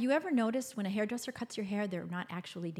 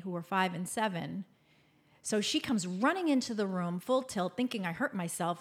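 usual speaking voice

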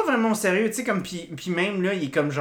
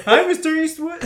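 high, loud laughing hello; mock Eastwood greeting; 12 semitones higher, 6 dB louder than usual. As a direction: toss out a jokey English hello. (salut moqueur en anglais)

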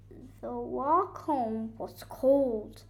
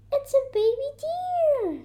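high, loud exclamation; high-pitched storybook surprise; surprised discovery; 14 semitones higher, 6 dB louder than usual. A narrator's reading. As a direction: exclaim at what you have found. Coco discovers a baby deer.

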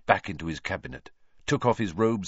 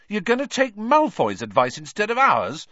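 high, loud, outraged question; furious; 8 dB louder than usual. A narrator's reading. Ron rebuking Harry for heeding Malfoy, furious. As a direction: explode in indignant disbelief. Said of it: "said Ron furiously".

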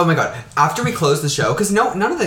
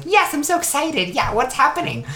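high, bright mock eagerness; eager kid voice; 13 semitones higher than usual. Boy answering the costume moms, in a high, eager squeak. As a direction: play the overeager kid. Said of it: "And I was like".

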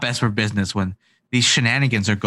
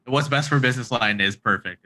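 ordinary talk

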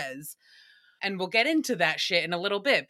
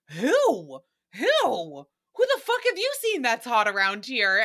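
Nicole's disbelief. Retold or imitated. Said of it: "Nicole was like".

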